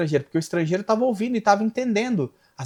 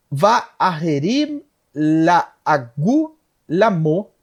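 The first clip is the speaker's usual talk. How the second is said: slow, drawn-out Hebrew reading; deliberate, stretched verse reading; scripture recitation; 3 dB louder, much slower than usual. Real, not acted.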